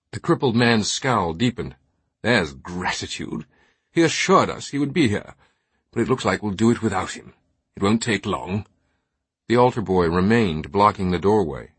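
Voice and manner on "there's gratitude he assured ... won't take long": highbrow British aristocrat, scowling and put out; haughty British knight, displeased. Acted. Knight brushing past the altar boy's objection, haughty British. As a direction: grumble loftily in upper-crust British.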